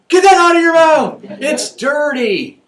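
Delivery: high, loud scolding shout, slowing on the last words; parent yelling. Retold or imitated. Parent shouting at a kid who put a quarter in his mouth, alarmed yell. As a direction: snap at a child to spit out a coin.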